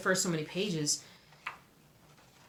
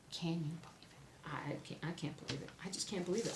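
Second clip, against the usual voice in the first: breathless hush of disbelief, much softer; awed whisper; 11 dB quieter than usual. Real, not acted. Vlogger confiding her find to viewers in an awed hush. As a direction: whisper it like a secret too good to be true.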